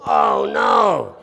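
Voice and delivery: loud, drawn-out mock groan of dismay; collective dismay. Whole class sees the professor walk in, loud mock dismay. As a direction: cry out in hammed-up dismay.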